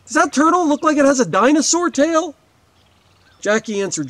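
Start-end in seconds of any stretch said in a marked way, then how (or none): 0.1-2.4 higher, louder call across the yard. Acted. Narrator calling to neighbor Jackie across the yard, loud call. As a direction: call her name across the garden. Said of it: "I called out".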